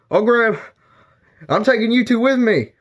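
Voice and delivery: high, hurried voice; urgent grabbing. Pretend. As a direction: rescue your pets in a rush. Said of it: "That's what I would be thinking".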